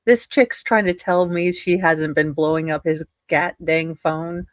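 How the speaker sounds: usual speaking voice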